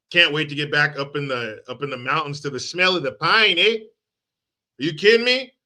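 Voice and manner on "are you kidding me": ordinary talk, nothing marked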